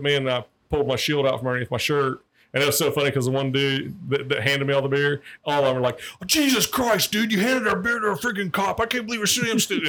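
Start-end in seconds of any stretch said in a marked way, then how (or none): 6.2-9.9 high, fast mock panic; panicked college guys voice. College guys realizing they handed beer to a cop, high and fast. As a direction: play the busted frat boys.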